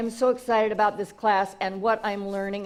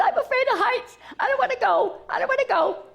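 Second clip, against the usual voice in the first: high, laughing mock fear; scared baby eagle; 14 semitones higher, faster than usual. Baby eagle refusing to leave the coop, high mock-scared voice. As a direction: whimper it like a chick afraid of heights. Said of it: "He says".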